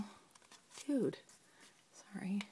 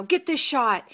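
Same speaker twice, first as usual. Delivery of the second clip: a slightly higher, louder coach's command; mock coach order; 5 semitones higher, 4 dB louder, slower than usual. Assistant coach lining up the kids, mock coach order. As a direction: imitate the pushy assistant coach. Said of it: "wants all the kids lined up".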